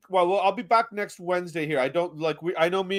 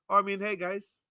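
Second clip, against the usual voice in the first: normal talking voice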